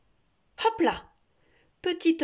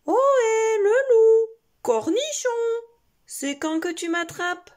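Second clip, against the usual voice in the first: high, loud taunting call; 12 semitones higher, 8 dB louder than usual. Acted. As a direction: call out to tease the wolf. Mother rabbit taunting wolf.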